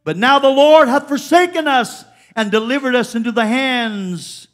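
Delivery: fervent scripture preaching; preaching at full force. Acted. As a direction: proclaim Gideon's lament to the church.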